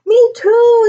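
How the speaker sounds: put-on high, loud excited child's shout; yelling out. A narrator's reading. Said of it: "yelled out Tony".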